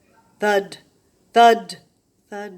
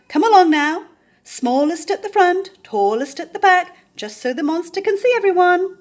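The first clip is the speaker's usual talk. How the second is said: higher, louder cheerful command; rallying call; 10 semitones higher, 9 dB louder than usual. A narrator's reading. Lion lines up the animals, rallying loudly. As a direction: marshal the troops heartily.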